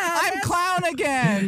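very high, slow, laughing clown whine; goofy clown voice. Retold or imitated. Back to class clown after the lockdown, high slow laughing whine. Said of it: "suddenly I was like".

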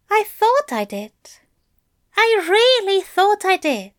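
Aziraphale voice. A narrator's reading. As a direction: lift the voice for the fussy angel. Aziraphale's long confession.

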